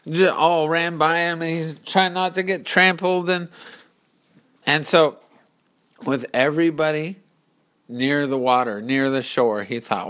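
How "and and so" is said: ordinary delivery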